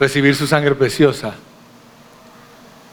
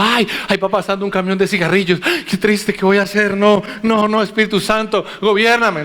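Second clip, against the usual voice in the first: higher, hammed-up mock anguish at temptation; mock struggling; 7 semitones higher than usual. Preacher mocking believers fretting over passing temptation: mock anguished pleading. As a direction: act out a weak believer begging for help, half-joking. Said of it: "ni a otra gente luchando".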